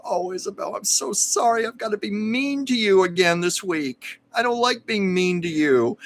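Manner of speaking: put-on apologetic old lady. Zara apologizing to Isabel at the table read, sweetly sorry. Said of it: "Zara would come to Isabel and go".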